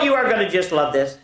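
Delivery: ordinary delivery, nothing marked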